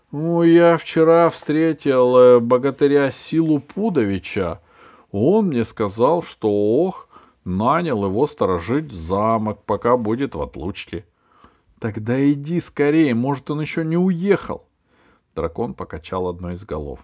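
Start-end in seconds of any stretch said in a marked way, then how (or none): 0.1-11.1 dragon voice. A narrator's reading. Dragon explains. 11.8-14.6 high, urgent pleading; urgent girl. A narrator's reading. Alice urges dragon.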